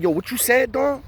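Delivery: high, disbelieving "what you said"; challenging question. Retold or imitated. Inmate confronting Dom over his remark, high and disbelieving. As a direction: call him out, half surprised, half threat. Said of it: "one of the dudes ... was like".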